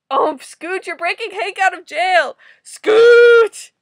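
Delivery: high, loud cry of exasperation; dismayed yelling. Real, not acted. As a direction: build to a full shout at the name. Dismayed outburst at comic events.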